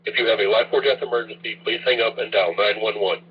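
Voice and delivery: normal talking voice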